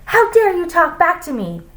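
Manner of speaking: high, outraged teacher; snapped. A narrator's reading. Teacher to a pupil who answered back, snapped. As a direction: snap in high-pitched indignation. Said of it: "snapped Missus Jules".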